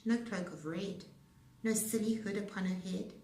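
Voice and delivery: plain reading voice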